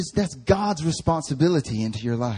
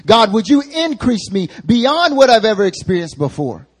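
loud, raised-pitch plea to God; preacher voicing Jabez pleading for increase, loud and high; crying out; 5 semitones higher, 6 dB louder than usual. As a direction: cry out for double blessing. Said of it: "what he's crying out for is".

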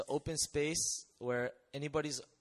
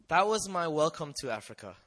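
ordinary talk

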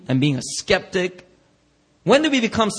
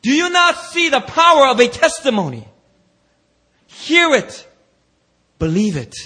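higher, louder preaching rebuke; voicing Jesus; 7 semitones higher, 6 dB louder than usual. Jesus rebuking the church, loud preaching. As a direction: declare it with power. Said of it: "he will come in here and say".

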